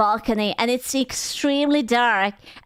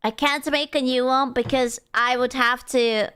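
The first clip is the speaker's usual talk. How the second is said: usual speaking voice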